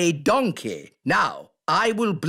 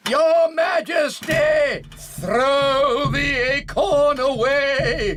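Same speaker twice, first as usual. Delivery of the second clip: urgent warning shout; 5 semitones higher than usual. Acted. Squirrel-disguised elf shouting a warning to the Queen. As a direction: shout the warning across to her.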